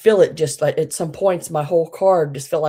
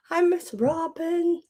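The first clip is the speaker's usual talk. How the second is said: high greeting; 13 semitones higher, slower than usual. Real, not acted. Greeting a viewer.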